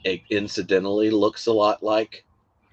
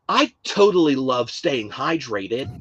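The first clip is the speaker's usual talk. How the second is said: slow, high, exaggerated American accent; hammed-up American gushing, pitched up; mock American influencer; 4 semitones higher, slower than usual. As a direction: parody an American influencer, stretching the words. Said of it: "whoever the stupid influencers".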